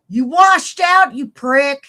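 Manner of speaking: high, loud, slow yelling insult; shouted taunt. Acted. Taunting the would-be astronaut, slow loud shouted insult. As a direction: yell it slowly at him.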